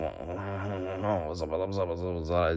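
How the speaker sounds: buzzing French gibberish noises; babbled French-accent nonsense; mock French babble. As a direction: slur a run of Z sounds like a cartoon French speaker. Imitating French sounds.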